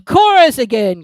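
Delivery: high, loud shout; Griffin cries out. A narrator's reading. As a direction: call for the chorus. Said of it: "cried the Griffin".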